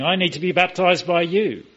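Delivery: high, loud retold objection; reluctant protest. John tries to deter Jesus from baptizing, emphatic protest. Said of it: "John is very reluctant".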